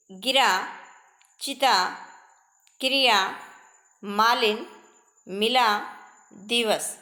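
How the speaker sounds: brief "i" in गिरा, चिता, क्रिया, मालिन, मिला, दिवस, read as a word list; short "i" vowel. Shown as a demonstration. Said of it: "ई के उच्चारण में कम समय लगता है".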